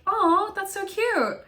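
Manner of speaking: high, slow cooing over something cute. Retold or imitated. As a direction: melt over a tiny onesie, very high and drawn out. Reacting to the baby onesie.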